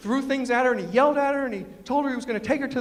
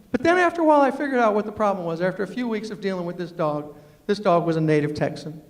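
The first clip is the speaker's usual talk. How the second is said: normal talking voice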